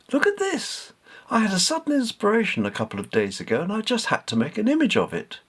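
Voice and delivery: high, eager voice rushing with excitement; bursting to show off her new painting, fast and high; Katy, excited. Acted. Katy showing the men her painting, excited high voice.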